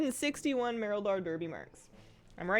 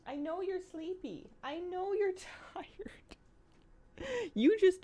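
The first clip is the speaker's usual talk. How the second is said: cooing pet talk; 12 semitones higher, 3 dB quieter than usual. Real, not acted. Owner coaxing her sleepy dog Moose to lie down, soft high cooing. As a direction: coax the big dog gently to bed.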